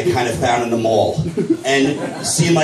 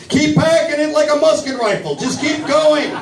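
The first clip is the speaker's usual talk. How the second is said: high, strained mock-panic cry; panicked instructions; 13 semitones higher than usual. Flustered dad coaching his daughter, high panicked cry. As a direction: call out orders in a high-pitched panic.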